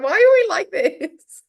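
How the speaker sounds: high, loud laughing lament. Real, not acted. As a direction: wail it out while cracking up. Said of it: "I'm laughing at your response".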